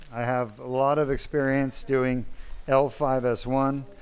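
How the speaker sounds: ordinary talk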